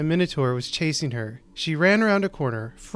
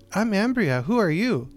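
put-on little girl voice; 5 semitones higher than usual. Child answers the fairy.

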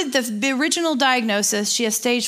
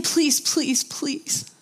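higher, softening desperate plea; retold emotional prayer, trailing softer; pleading prayer; 6 semitones higher, 3 dB quieter, slower than usual. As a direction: beg God for a friend, pitch lifted. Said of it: "I just was saying".

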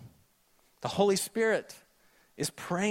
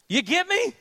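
ordinary talk, nothing marked

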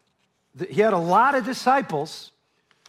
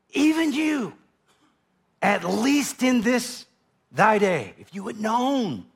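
lament; 2 semitones higher, slower than usual. Acted. Jesus lamenting over city.

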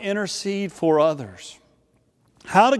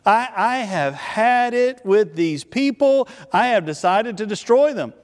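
higher, emphatic divine declaration; emphatic exasperation; 4 semitones higher than usual. Acted. God tells Moses he's done with these people, emphatic exasperation. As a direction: draw it out higher, as a decree. God's decree, repeated more forcefully.

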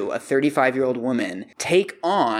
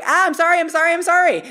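high, loud panicked squeal; panicked apology; 6 dB louder, faster than usual. Retold or imitated. Burglar begging the kicking professor, high panicked yelps. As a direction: yelp it out like a scared intruder fleeing.

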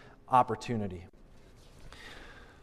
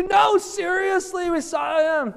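high, loud, slow insisting; insistent shout; 11 semitones higher, 5 dB louder than usual. Acted. Disciples insisting to doubting Thomas, high and loud. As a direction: plead to be believed, almost shouting.

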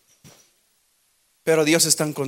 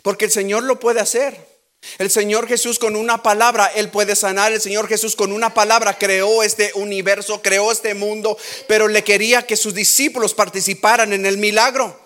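rising louder preaching; preaching building; 5 semitones higher, 4 dB louder than usual. Real, not acted. Preacher urging the church to act, building fervour. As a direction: build toward the climax.

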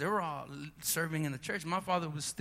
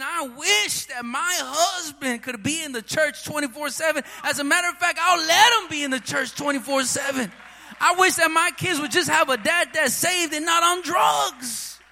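retold shout, higher and louder; a mother's indignant shout in church; angry mother; 8 semitones higher, 12 dB louder than usual. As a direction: fire back at the gossiping women. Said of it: "My mother became so angry".

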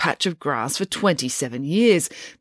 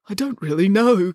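a lightly sheepish admission; father admitting ignorance to a journalist; sheepish confession; 3 semitones higher than usual. Acted. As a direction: own up, a bit embarrassed. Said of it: "confessed Mister Green".

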